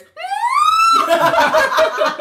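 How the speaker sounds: high, loud squealing laugh. Retold or imitated. Ali squealing in the lift at Jason, loud laughing squeal. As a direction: let out a proper shrieking squeal. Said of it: "proper squeal".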